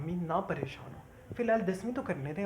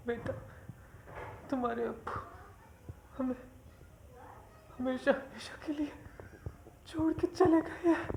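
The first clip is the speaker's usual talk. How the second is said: sobbing; 7 semitones higher than usual. Acted. Mother tells her daughter the father has died, sobbing. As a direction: let the grief crack the words. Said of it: "आप रो क्यों रही हैं?".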